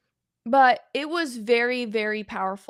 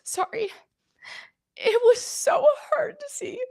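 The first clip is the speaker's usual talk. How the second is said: tearful, higher pitched voice breaking; crying; 5 semitones higher than usual. Real, not acted. Vlogger recounting the Nova atrocities to viewers, crying. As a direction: talk through real tears, apologizing. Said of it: "I feel like Rachel Maddow crying".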